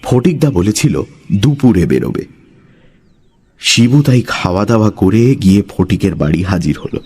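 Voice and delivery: ordinary delivery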